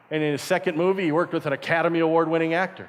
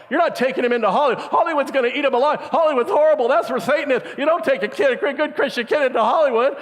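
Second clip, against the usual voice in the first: higher, louder and fast, an alarmed relative; hammed-up frantic warning, higher and louder; alarmed family; 7 semitones higher, 4 dB louder than usual. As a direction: raise the alarm like a panicked aunt. Family calling, alarmed about Hollywood.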